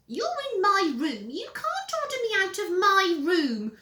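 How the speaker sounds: put-on high, loud child voice; whiny little brother. Peter catching Henry in his room, high whine. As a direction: pipe up in a high brat voice.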